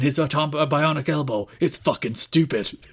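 mock ominous campaign ad; political attack ad voice. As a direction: sell it like a smear ad. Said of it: "the full political attack ad".

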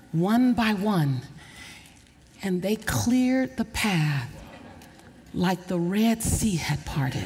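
drawn out and solemn; slow down to let the image land; slow, weighty. Acted. Preacher describes the cars parting, slow and solemn.

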